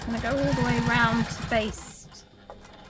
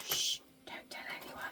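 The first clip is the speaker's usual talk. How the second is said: playful conspiratorial whisper; hushed secret; 8 dB quieter than usual. Pretend. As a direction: shush them and whisper it like a secret. Playful secret shared with viewers.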